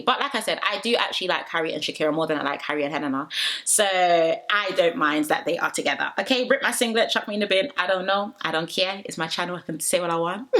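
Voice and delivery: ordinary talk, nothing marked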